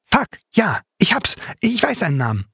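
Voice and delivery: high, fast euphoric outburst; euphoric rattle. A narrator's reading. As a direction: burst out with a rattling thrill. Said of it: "Euphorisches Rasseln in seiner Stimme". (euphorisch, rasselnde Stimme)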